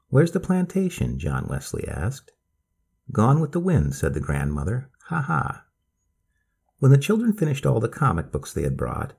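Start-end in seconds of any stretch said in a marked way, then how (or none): 0.1-1.2 put-on high, curious boy; child voice. A narrator's reading. John Wesley asks. 3.1-3.9 put-on a bit higher, storytelling old lady; grandma voice. A narrator's reading. Grandmother jokes. 5.0-5.6 light, high grandma chuckle; old lady chuckle. A narrator's reading. Grandmother laughs at own joke.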